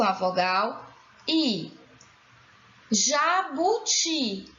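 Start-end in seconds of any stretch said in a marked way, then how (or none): 2.9-4.5 "Jabuti" slow, syllables stretched. Shown as a demonstration. Teacher showing the stressed syllable, "Jabuti" slowly sounded out. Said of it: "A sílaba tônica".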